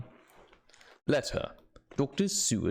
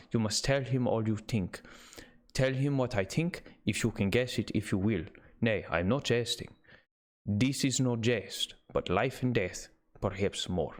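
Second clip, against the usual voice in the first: put-on Dutch accent. Van Helsing urging Seward to tell Arthur everything, in a Dutch accent. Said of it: "Close enough".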